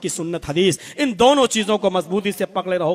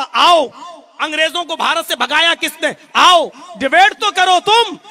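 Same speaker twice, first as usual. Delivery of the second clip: raised, high, defiant call to debate; loud challenge; 6 semitones higher, 6 dB louder than usual. Acted. Cleric daring news channels to debate him, loud and high. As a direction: dare the TV channels to bring you on.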